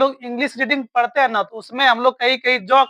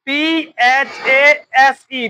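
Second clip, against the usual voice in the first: slow spelling; much slower than usual. Shown as a demonstration. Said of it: "क्या देखो".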